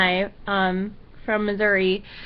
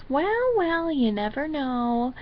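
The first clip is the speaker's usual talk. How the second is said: grandma voice; 4 semitones higher, 4 dB quieter, slower than usual. Retold or imitated. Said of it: "there's my grandma, just goes".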